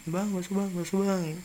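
high but held-back calling; mock kid's yell. Kids hiding behind a fence, mock calling the vendor. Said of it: "sambil jerit-jerit".

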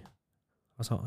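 ordinary talk, nothing marked

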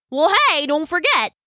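high, eager interjection; excited. A narrator's reading. Crab jumping in to cheer up his friend, excited. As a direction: jump in with pitch well up. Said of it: "said Brock excitedly".

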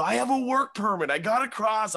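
very high-pitched insistence; desperate protest. Retold or imitated. Comedian convincing people who want to kill him, desperate. Desperate defence to disbelieving crowd.

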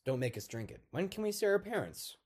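put-on high-pitched child's plea; acted whiny begging, rising high; pleading children. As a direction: plead like a little kid. Voicing the children's pleas.

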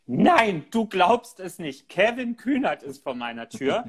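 high-pitched mock cry of disbelief, stretched out; aufgeregter Aufschrei, nachgeahmt. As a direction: imitate a woman shrieking the news into her phone. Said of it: "schrie sie". (nachgeahmter, ungläubiger Aufschrei in hoher Stimme)

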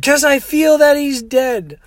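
high, slow mock wail; sobbing. Sobbing woman explains her fear to driver, mock wailing. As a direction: insist on it in a hammed-up sob. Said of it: "she's sobbing and sobbing".

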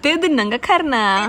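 "Titnang Akarna" copied from the machine voice, slow and rising; "Titnang Akarna" echoed like the Google Indonesian voice; imitating Google Indonesian. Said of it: "I just wanna hear the Indonesian version".

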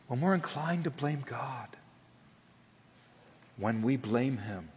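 whispering to the congregation. Real, not acted. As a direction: drop to a whisper. Hushed sermon aside.

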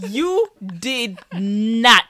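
loud, very slow "You did not"; emphatic denial. Real, not acted. Sister insisting on her version of events, slow emphatic denial of "You did not". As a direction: stretch out each word of "You did not". Drawn-out contradiction in playful quarrel.

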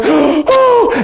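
imitated high, tearful gasp; mock a crying, astonished "oh!"; high cry of amazement, acted out. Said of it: "crying, going".

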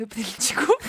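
high burst of real laughter while retelling; laughing at herself for crying; laughing hard. Real, not acted. Laughing to a friend about crying in a taxi, high laughter.

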